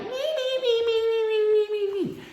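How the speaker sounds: very high squeaky mimicry of a yapper; mock his chatter in a tiny high-pitched whine; squeaky nonstop yap, making fun of him; mocking yapping. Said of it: "He just kept yapping".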